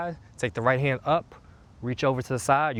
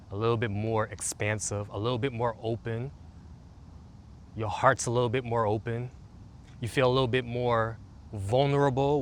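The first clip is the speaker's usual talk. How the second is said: guided meditation. Acted. Coach leading listeners through a calming routine, soothing meditation voice. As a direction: guide them gently into the body.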